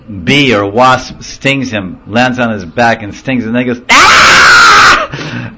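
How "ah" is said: put-on sudden high, loud baby shriek; piercing glass-breaking wail; imitated crying scream. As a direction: scream like a stung child. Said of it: "Then, then he cries".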